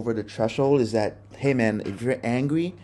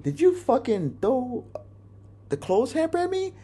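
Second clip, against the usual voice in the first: incredulous question; 14 semitones higher than usual. Retold or imitated. Retelling reaction to thrown hamper.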